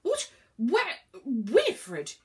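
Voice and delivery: put-on muddled old god, bursts of loud and high; bemused, spluttering questions; burbled. A narrator's reading. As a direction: burble out startled, confused questions. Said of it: "he burbled".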